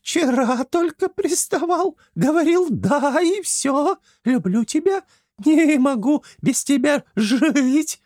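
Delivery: high, slow, hammed-up hag voice; mock kikimora. Франси plays the kikimora to tease Flappy, high mock voice. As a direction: play along as the monster. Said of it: "подыграла Антону Франси".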